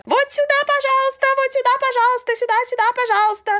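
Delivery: high, fast, eager directing; chirpy airport helper ushering; polite Japanese staff voice. Retold or imitated. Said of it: "стоят на каждой станции... и говорят".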